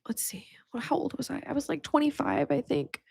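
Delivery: whispered aside to oneself; thinking aloud. Real, not acted. Speaker trying to recall own age, thinking aloud in a whisper. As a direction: drop to a near-whisper, working it out.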